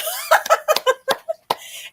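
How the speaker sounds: high laugh. Real, not acted. Reacting to his t-shirt.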